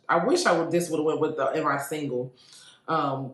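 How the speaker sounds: ordinary talk, nothing marked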